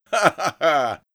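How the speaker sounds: mocking belly laugh, acted out; big hearty ha-ha of a thug; loud guffaw. A narrator's reading. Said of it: "Оба мордоворота расхохотались в голос".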